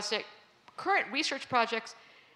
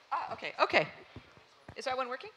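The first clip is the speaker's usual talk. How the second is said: ordinary talk, nothing marked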